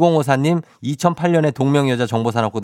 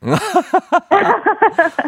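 high laugh. Real, not acted. Host laughs loudly.

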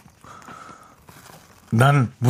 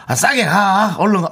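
Jeolla dialect; 6 semitones higher than usual. Retold or imitated. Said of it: "이게 전라도 말인가?".

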